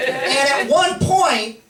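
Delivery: ordinary talk, nothing marked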